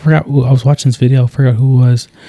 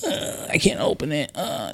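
softer, higher mock whine of a child giving up; mock whiny kid; 5 semitones higher, 12 dB quieter than usual. Dad mimicking his daughter's whining when she gives up, mock whiny. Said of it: "Don't do one of these".

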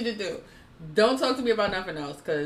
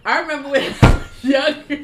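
higher, loud line breaking into laughter; can barely get the words out for laughing; laughing harder; 3 semitones higher, 6 dB louder than usual. Real, not acted. Restarting a story to friends, cracking up.

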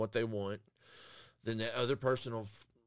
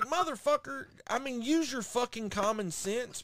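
much higher, exasperated scolding; exasperated rant; 10 semitones higher than usual. Retold or imitated. Customer scolding the new drive-thru worker, exasperated. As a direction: scold him for lacking sense. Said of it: "I'm just like".

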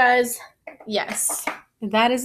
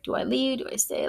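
softer, hesitant inner debate; contemplating in her head; 3 semitones higher, 7 dB quieter than usual. Retold or imitated. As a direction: voice a private thought, retold. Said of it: "she's contemplating in her head".

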